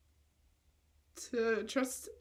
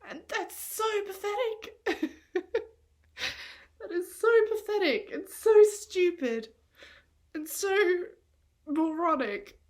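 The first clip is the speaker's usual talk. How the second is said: higher and much louder, crying through it; tearful self-scolding; 6 semitones higher, 7 dB louder than usual. Real, not acted. Calling herself pathetic after being cheated on, tearful outburst. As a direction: scold yourself through tears.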